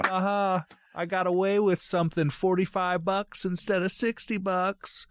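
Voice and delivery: put-on high, taunting teenage gloat; mock gloating. Acting out his teenage self fooling the store, gloating.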